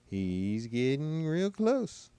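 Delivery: drawn-out phrase climbing high to "close"; mimicked telltale delivery. Commentator mimicking a witness he says is lying, slow climb to "close". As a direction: stretch it slowly, sliding up into "close". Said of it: "you can tell when she goes".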